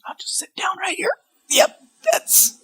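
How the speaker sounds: tiny, high, whispery squeak; softly squeaked mock timidity; meek cowed whisper. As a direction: shrink away from the scary dad. Voices his own cowed self.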